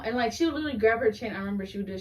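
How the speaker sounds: usual speaking voice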